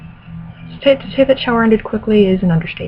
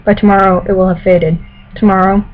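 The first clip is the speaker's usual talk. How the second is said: louder, careful novel reading voice; story narration; 5 dB louder than usual. Acted. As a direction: read it like an audiobook. Reads novel passage aloud.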